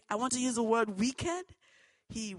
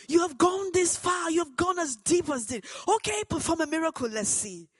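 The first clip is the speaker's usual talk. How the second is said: louder, high, fast taunting yell; mocking scream; 7 semitones higher, 8 dB louder than usual. Father yelling a taunt at his daughter, high and loud. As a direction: dare her to prove it, yelling. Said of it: "He screamed and said".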